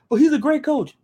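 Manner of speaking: high-pitched objection, imitating a recruit; mock protest. Acted protest of recruit to family.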